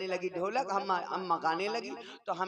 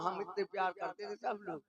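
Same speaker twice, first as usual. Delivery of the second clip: normal talking voice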